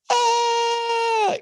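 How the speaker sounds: high, loud exasperated "ah"; mock frustrated cry. Caught between calm and duty, a sudden high loud cry. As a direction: burst out with a frantic high "ah".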